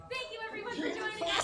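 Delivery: laughing. Real, not acted. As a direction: laugh while signing off. Laughing through hot sauce pain.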